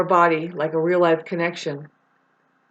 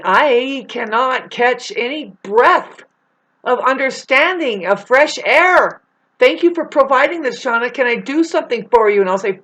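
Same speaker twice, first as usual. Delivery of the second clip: heated rant; 5 semitones higher, 5 dB louder than usual. Real, not acted. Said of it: "irate and, and angry".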